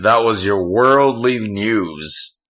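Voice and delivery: slow, drawn-out newscaster sign-off; radio news voice. Pretend. Co-host closing a mock world news segment, radio news voice. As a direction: stretch it out like a radio news anchor. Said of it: "That's a pretty good news voice".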